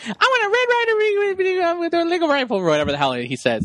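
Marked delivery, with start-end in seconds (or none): 0.0-2.6 put-on high, tumbling child voice; imitated wishing kid. Host as A Christmas Story's kid, mock-eager babble. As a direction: gabble the wish like an overexcited kid. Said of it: "he's like".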